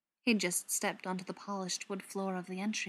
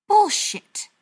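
high, very loud angry outburst; explosive, furious dismissal; spat out; 13 semitones higher, 13 dB louder than usual. A narrator's reading. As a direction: spit it out furiously. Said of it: "the butcher spat".

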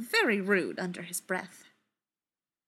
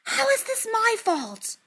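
much higher, offended protest; indignant hiss; 13 semitones higher than usual. A narrator's reading. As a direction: defend yourself, stung. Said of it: "Fili hisses".

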